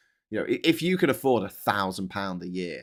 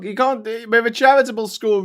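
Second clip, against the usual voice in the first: mocking private school; 11 semitones higher, 5 dB louder than usual.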